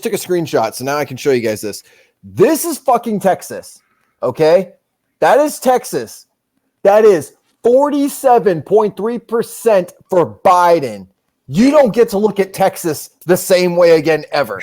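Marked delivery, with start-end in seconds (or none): 2.2-14.2 slow, weighty emphasis on each phrase; emphatic. Real, not acted. Streamer showing viewers the Texas numbers, emphatic. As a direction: drive every phrase home, slowly. Hammering home the Texas result.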